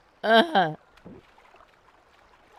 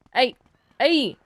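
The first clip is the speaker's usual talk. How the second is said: louder, higher shout of hey; calling out; 3 semitones higher, 7 dB louder than usual. Real, not acted. Player calling his companion in the game, loud call. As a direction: yell to get her attention.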